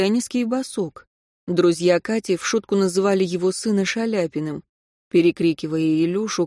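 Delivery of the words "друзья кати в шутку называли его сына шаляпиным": ordinary delivery, nothing marked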